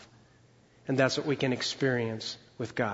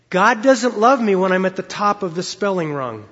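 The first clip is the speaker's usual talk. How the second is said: ordinary talk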